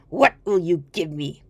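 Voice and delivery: low crafty little goblin; sly hobgoblin. Acted. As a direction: bargain like a sly imp. Hobgoblin bargains.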